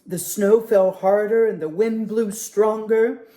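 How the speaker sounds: plain reading voice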